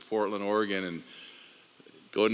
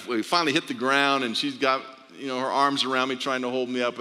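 usual speaking voice

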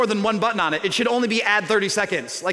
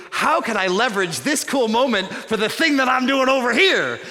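higher, louder, eager inner voice; eager scheming; 5 semitones higher, 4 dB louder than usual. Retold or imitated. Preacher mocking his own scheming thought, eager and louder. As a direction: sell it like a schemer.

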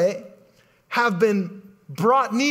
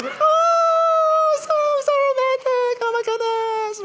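swooning women; slower than usual. Retold or imitated. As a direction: gush like a lovestruck moviegoer, way up high. Mimicking swooning women in audience.

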